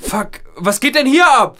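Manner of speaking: high, frightened yell; panicked shout. Acted. Woken by strange noise at night.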